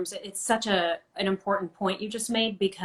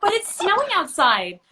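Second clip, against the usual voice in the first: mock whiny protest; 11 semitones higher, 4 dB louder than usual.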